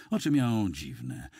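ordinary delivery

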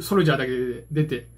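ordinary talk, nothing marked

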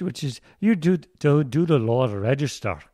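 guttural Scottish burr; Scottish accent. Shown as a demonstration. Guest coaching host on accents, guttural Scottish. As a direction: demonstrate Scottish, throaty in the vowels and r's. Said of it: "if you're gonna do Scottish".

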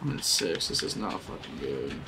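low, soft, deflated; dejected. Real, not acted. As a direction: mutter it in defeat. Dejected after hits.

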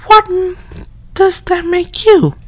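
high put-on girly voice; girl voice. Yama's friend calling through the door in a high girl voice. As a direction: pitch way up into a girl's voice. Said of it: "my girl voice".

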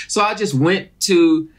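ordinary talk

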